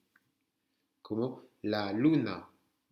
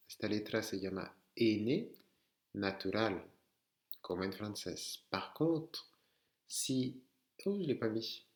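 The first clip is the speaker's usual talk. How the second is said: ordinary talk, nothing marked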